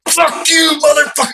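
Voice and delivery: very high, strained retold scream; imitating an enraged high-pitched yell; raving mad. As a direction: curse furiously after someone. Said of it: "I'm, like, fucking raving mad".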